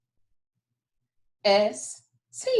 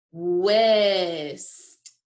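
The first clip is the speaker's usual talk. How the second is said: drawn-out word; 3 semitones lower, 3 dB louder than usual. Shown as a demonstration. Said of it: "Our next word is".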